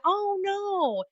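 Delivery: very high, drawn-out retold dismay; hammed-up, high-pitched horror; mock dismay. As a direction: let it soar up in exaggerated dismay. Retold reaction to declined card.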